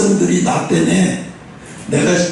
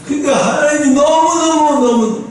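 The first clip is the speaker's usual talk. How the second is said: ordinary delivery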